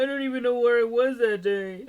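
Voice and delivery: high mock-helpless protest; hammed-up denial. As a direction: plead total cluelessness, overplayed. Listener joins in as accused character.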